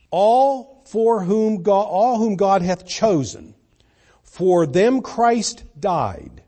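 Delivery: loud, ringing pulpit reading of scripture; fervent preacher declaiming scripture; full-force preaching. Acted. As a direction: proclaim the verses to the whole church.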